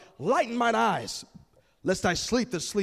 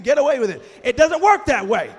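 loud preached rebuke; shouted rebuke; 3 semitones higher, 9 dB louder than usual. Real, not acted. Preacher shouting down sinners' excuses. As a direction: hit it hard and loud.

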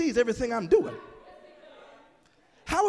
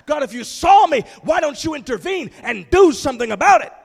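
shouting up at God, much louder and higher; desperate cry to God; 5 semitones higher, 11 dB louder than usual. Pretend. Praying person shouting at God in despair. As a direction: cry out in anguish, demanding an answer.

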